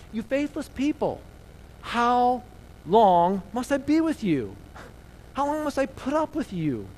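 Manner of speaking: high-pitched, drawn-out exasperation; frustrated Jesus. Acted. Preacher as Jesus rebuking faithless people, frustrated. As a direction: plead in exasperation, voice pitched well up. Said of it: "he gets a little frustrated with people".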